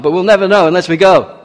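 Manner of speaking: rally your companion into a daring attack; rousing rally. Acted. Jonathan urging his armor-bearer into battle, rousing and loud.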